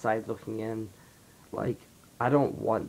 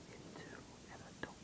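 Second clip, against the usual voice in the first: dramatic whisper; 22 dB quieter, faster than usual. Acted. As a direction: drop to a hushed whisper. Dramatic reveal turning eighteen.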